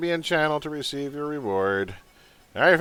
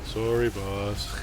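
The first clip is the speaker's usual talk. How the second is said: low, drawn-out henchman apology; Hopkins; 7 semitones lower, 5 dB quieter, much slower than usual. Acted. Underling Hopkins apologizing to the boss, slow and low. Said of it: "Your Hopkins sounds an awful lot like my Malakath".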